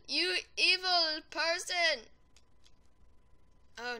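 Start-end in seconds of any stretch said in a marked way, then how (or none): 0.1-2.1 slow outraged yell. Real, not acted. Yelling at the player who killed him.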